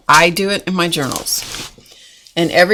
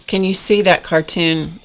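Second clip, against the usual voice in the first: ordinary talk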